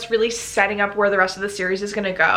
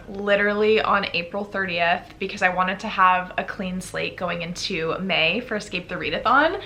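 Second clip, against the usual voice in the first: ordinary talk, nothing marked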